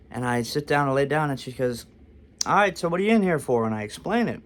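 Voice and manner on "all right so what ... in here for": high, fast mock questioning; hurried, curt imitation; brusque nurse. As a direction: rattle it off like an impatient nurse. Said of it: "this lady absolutely hates me".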